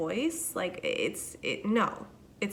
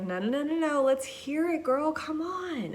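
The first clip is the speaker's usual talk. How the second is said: coaxing coworkers; 8 semitones higher, 4 dB louder than usual. Retold or imitated. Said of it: "They're like".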